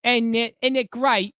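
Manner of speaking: high-pitched, hammed-up British accent. Shown as a demonstration. Host answers viewer's challenge, high mock British accent. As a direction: play a chirpy Brit, lilting up high. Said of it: "How well can you do a British accent?".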